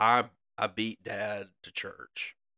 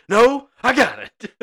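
high, loud retold dad outburst; mock exasperated shout; frustrated dad; 14 dB louder, slower than usual. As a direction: mimic a stubborn dad yelling, drawn out. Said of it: "Dad started getting frustrated".

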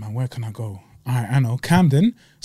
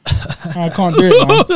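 breaking up, slow, mid-laugh; can't get the words out for laughing; 2 semitones higher, 3 dB louder, slower than usual. Real, not acted. Giving up the bit while he laughs at himself, laughing.

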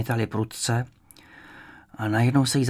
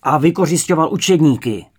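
loud, higher outburst; shouted accusation; 4 semitones higher, 8 dB louder than usual. A narrator's reading. Wicked interrogator accusing the family, shouting. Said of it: "vykřikl ten zlý".

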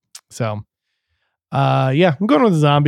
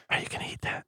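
very soft voiceless whisper; ASMR whisper; 18 dB quieter, much faster than usual. Acted. Asking about someone's food, ASMR whisper. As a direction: whisper it close and quiet. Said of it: "questions in an A S M R voice".

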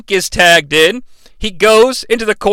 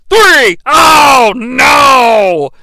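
loud, drawn-out wail; anguished shout; 4 semitones higher, 5 dB louder, much slower than usual. Acted. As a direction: stretch the loss out slowly. Losing pinfall call.

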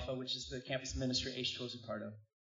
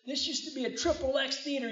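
preacher quote; 12 semitones higher, 4 dB louder than usual. Retold or imitated. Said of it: "he goes".

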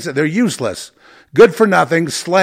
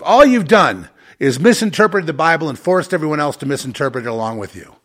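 ordinary delivery

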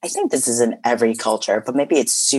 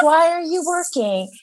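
much higher, put-on mother voice; hammed-up much higher mom imitation; high mock mom voice. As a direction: squeak it out like a scolding mom. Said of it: "She was yelling at me".

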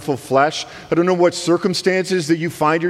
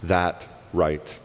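slow, softer reader's challenge; quiet rhetorical question; 5 semitones lower, 5 dB quieter, much slower than usual. Retold or imitated. Paul voicing the imagined reader, slow and soft. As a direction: let the question hang, slow and low. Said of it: "he says this".

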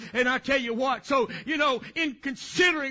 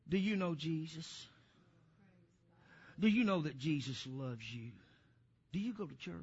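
very soft, low and hushed; imitated serious child; 9 semitones lower, 12 dB quieter than usual. Little girl asking a supermarket stranger, hushed and serious. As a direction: gently imitate an earnest child, almost under the breath. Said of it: "she's just as serious".

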